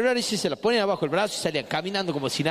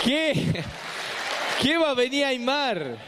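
high startled what; hammed-up disbelief; mock surprise; 6 semitones higher, slower than usual. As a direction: bark a startled question. Mimics audience reaction.

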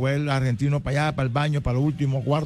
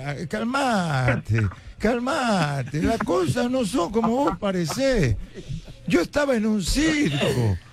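higher Argentine sing-song excuse; Argentine lover; 10 semitones higher than usual. Retold or imitated. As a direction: soothe him with an Argentine lilt. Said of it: "escuchar al chico hablar argentino". (amante argentino, voz aguda y cantarina)

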